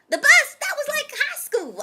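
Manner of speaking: high-pitched outburst of dismay; appalled disbelief. Retold or imitated. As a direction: recoil at the very idea, voice shooting up. Acts out horror at taking the bus.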